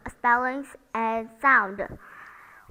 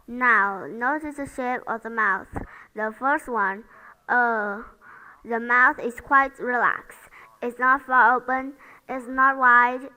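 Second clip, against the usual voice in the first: normal talking voice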